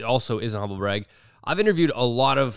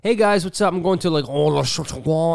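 mock French; 4 semitones higher than usual. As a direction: play a showy vlogger who slips into French. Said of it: "there's like".

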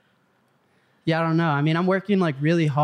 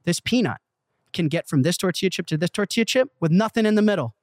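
excited sales pitch; 6 semitones higher than usual. Retold or imitated. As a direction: re-enact a fired-up pitch, voice riding high. Re-enacts his excited pitch to executive.

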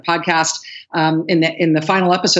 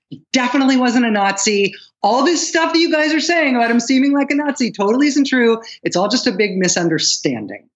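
higher-pitched imitation of insistent excuse-making; mock explaining away; 5 semitones higher than usual. Guest voicing the widow defending her husband, mock explaining away. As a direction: play a widow earnestly denying it all. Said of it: "trying to explain away everything".

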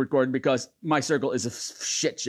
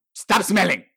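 gargoyle scolding; 2 semitones higher, 5 dB louder, slower than usual. Acted.

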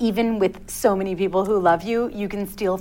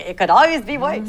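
put-on higher, louder Yiddish-inflected New York comic accent; lightly hammed Yiddish-inflected comic accent; Jackie Mason accent; 6 semitones higher, 6 dB louder than usual. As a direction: deliver it like an old Borscht Belt comic with a Yiddish lilt. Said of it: "say it in the Jackie Mason accent".

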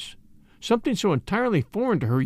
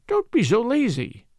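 higher, lively mild protest; crying out; 6 semitones higher than usual. A narrator's reading. Daughter urging her father to travel, crying out. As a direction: cry out in playful reproach. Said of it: "cried Ruth".

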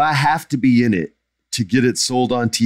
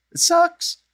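squeaky high voice. Real, not acted. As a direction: voice jumping way up high. Repeats it sucks, squeaking.

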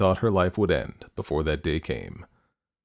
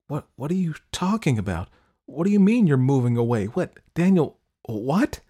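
high, stammering disbelief growing louder; shocked, struggling to speak; 7 semitones higher, 4 dB louder than usual. A narrator's reading. Girl hearing boyfriend is leaving, shocked and stammering. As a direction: demand answers in high-pitched shock. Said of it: "it was difficult to speak".

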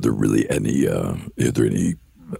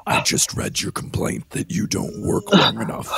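breathy demonic rasp; Satan hissing it out. Acted. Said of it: "doing a demonic voice".

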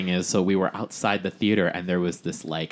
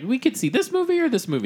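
high quoted voice. Retold or imitated. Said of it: "I was like".

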